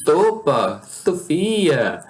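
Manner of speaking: "sopa, Sofia" with each S lisped as TH; tongue-between-teeth lisp. Retold or imitated. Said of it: "da língua entre os dentes".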